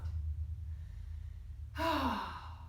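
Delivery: soft, long relaxed exhale; contented sigh. Real, not acted. Teacher relaxing after the mantra, soft sigh. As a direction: breathe out, fully at ease. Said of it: "and I am loved".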